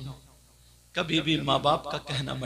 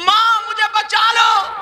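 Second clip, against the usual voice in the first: cried out higher and louder; girl's scream; 13 dB louder, slower than usual. Retold or imitated. Girl screaming to her mother for help. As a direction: make the last plea a real shout. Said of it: "लड़की चीखने लगी".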